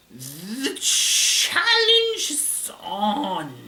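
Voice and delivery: put-on extremely slow, high Italian accent; drawn-out Italian-accented challenge; Ghidorah. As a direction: stretch each word out, Italian-style and theatrical. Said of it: "like, drawn out".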